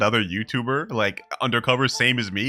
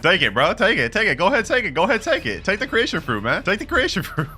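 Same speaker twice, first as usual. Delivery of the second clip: high, rapid-fire goading; excited urging; 8 semitones higher than usual. Real, not acted. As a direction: egg them on, voice pitched right up. Urges scammer to take the fruit.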